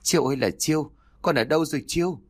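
high, plaintive mother's lament; tearful lament. A narrator's reading. Mother calls for her missing son, tearful lament. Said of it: "bà Mai bắt đầu sụt sịt, miệng của bà lẩm bẩm".

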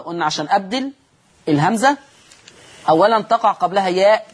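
ordinary talk

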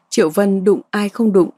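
ordinary delivery, nothing marked

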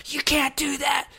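high then whispered; a drunk urgent hush. Retold or imitated. Quoting self, drunk scolding.

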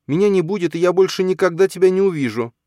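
put-on high, loud, shaky-edged boy's lament; a frightened boy fighting tears; boy fearing he'll vanish, voice trembling; trembling child voice. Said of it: "его голос дрожал".

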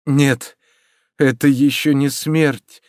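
understated tearful protest; through sobs. A narrator's reading. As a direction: stretch it out as if holding back tears. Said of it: "проговорил он сквозь рыдания".

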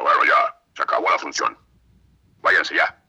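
imitated loud dismissal by a director; big booming voice. Director ending the session for visitors, big loud voice. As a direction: boom it out, sending everyone away. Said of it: "qué vocesota".

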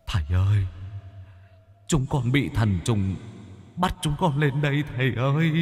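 high, thin wailing plea; a high ghostly whimper for mercy; pleading ghost. Acted. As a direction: beg like a frightened lost soul.

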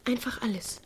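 breathy promise, whispered close; seductive whisper. Acted. Carrie lures Pete with a promise, seductive whisper. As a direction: whisper it like a temptation.

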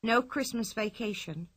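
usual speaking voice

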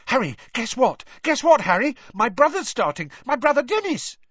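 high, loud eager chatter; breathless excitement. Acted. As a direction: gush about his brother. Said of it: "highly excited, breathless voice".

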